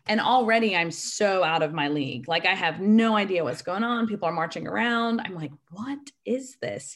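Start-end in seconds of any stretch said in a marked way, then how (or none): 5.7-7.0 slow, high, baffled "what is this"; retold bewilderment. Freshman baffled by people marching around, bewildered. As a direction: drag it out, mildly incredulous.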